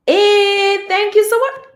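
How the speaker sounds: ordinary delivery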